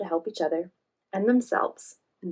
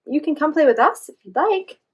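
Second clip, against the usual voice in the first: higher, louder friendly invitation; 6 semitones higher, 9 dB louder than usual. Acted. Joy voiced inviting giraffe.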